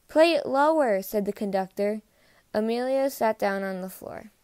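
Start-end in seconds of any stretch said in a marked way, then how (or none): 0.1-1.0 loud, high exasperated command; raised-voice order. A narrator's reading. Conductor tries to tame the triangle, loud order. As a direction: call out over the clanging.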